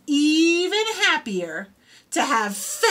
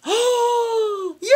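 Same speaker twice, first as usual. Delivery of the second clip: excited cheer; 8 semitones higher than usual. Acted. Flute saved, child cheers.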